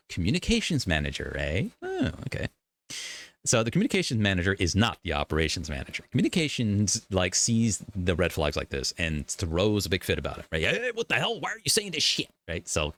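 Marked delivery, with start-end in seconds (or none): 1.8-2.5 mock surprise. Real, not acted. Mock surprise at job title. 10.6-12.3 high, indignant outburst; a manager throwing a fit at coworkers; upset manager. Retold or imitated. Communications manager scolding the team, high and indignant.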